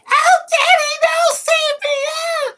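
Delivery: put-on very high squealing child voice; mock an annoying screaming kid; hammed-up high child crying for daddy; whiny kid mockery. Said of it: "They're not like".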